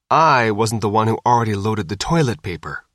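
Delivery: ordinary delivery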